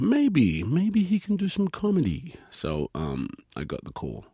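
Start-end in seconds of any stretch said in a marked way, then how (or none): 0.1-2.3 much higher, wondering mock producer voice; imitating producers toying with an idea; musing producers. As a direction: float it up as if the idea just dawned. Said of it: "made them think".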